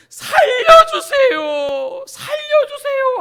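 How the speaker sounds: desperate, high wailing shout; full-force high-pitched cry for rescue; screaming for help. Acted. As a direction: scream it as if drowning, drawn out. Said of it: "하고 울부짖으면서".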